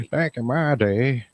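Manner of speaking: hammed-up cranky grandpa; old man voice. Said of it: "My old man voice".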